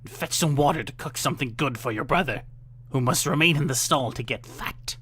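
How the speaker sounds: put-on bully the girl awake; loud harsh witch scolding. A narrator's reading. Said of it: "the witch said".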